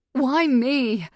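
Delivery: high, loud desperate question; frightened plea. Acted. Captive questioning her captor, frightened plea. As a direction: plead in alarm.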